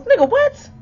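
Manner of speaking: high, loud disbelieving yelp; shocked what. Retold or imitated. Realizing the caller is her ex himself, shocked. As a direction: jolt up in disbelief. Sudden realization mid-story.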